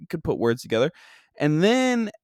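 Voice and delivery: ordinary delivery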